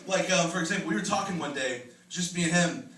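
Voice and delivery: ordinary talk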